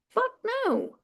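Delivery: high, sharp burst of refusal; a sudden high fuck no; emphatic refusal. Real, not acted. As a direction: spit out the refusal. Emphatic answer to own question.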